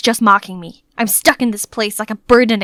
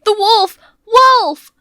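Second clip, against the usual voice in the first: higher and louder, rising to a scream; panicked shouting; 11 semitones higher, 5 dB louder, slower than usual. Acted. Gardeners warning everyone of the wolf, panicked shouts. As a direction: yell the alarm in panic.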